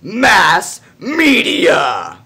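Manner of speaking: slow, grand sales-pitch delivery; slow announcer pitch. Acted. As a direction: announce it like a cheesy promo.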